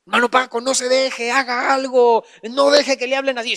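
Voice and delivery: a touch of mock urgency; egging-on busybody. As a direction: goad him like an indignant friend. Imitates meddling church people egging him on.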